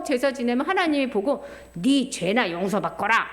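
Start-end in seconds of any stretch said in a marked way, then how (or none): 1.7-3.2 low, stern voice of God; a hint of God scolding the priest; God's voice. God rebuking a sinful priest, low and stern.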